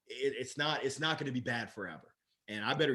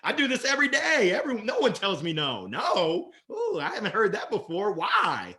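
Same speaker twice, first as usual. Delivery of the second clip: playful sales push; 6 semitones higher, 6 dB louder than usual. Shown as a demonstration. Salesman pushing back on no.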